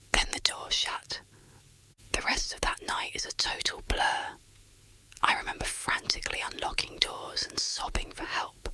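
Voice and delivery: plain reading voice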